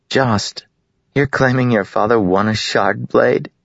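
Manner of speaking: a hint of a girl doubting him; mocking girl. A narrator's reading. Laurel mocking Jost.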